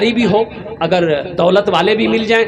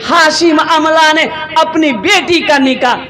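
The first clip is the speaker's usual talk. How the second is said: rousing preaching; 6 semitones higher than usual. Acted. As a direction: preach it to a crowd, building to the reveal. Preaching to a crowd. (जोशीली तक़रीर)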